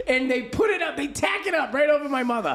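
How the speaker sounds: high-pitched incredulous rant; worked-up retelling. Real, not acted. Comic telling sister's crucifix stunt over dying mother, worked up. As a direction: rattle it off high and scandalized.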